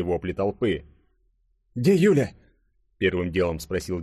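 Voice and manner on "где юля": high, louder urgent demand; anxious question. A narrator's reading. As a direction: ask at once, alarmed for a friend. First anxious question on waking. (тревожный вопрос, высоко и громко)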